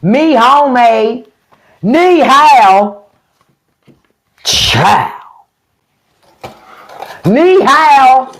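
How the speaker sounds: a hint of a Southern drawl on clumsy Mandarin. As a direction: imitate a Southern farmer butchering Mandarin greetings. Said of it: "speak those languages with a Southern drawl".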